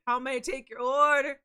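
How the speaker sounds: a hint of a drive-thru cashier. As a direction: greet like a shop clerk. Cashier role play.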